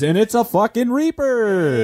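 high, slow exasperated yell; frustrated outcry. Real, not acted. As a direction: wail it out in disbelief. Spun the hottest bean again.

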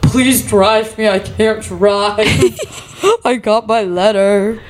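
high, slow, drawn-out mock wail; hammed-up weepy begging; mock hysterical sobbing. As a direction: imitate pleading through sobs, stretching the words. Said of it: "I was, like, hysterically sobbing".

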